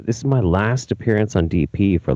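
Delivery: ordinary delivery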